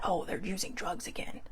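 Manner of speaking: hushed, judgy aside; imitating people who judge addicts; mocking whisper. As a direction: sneer it under your breath.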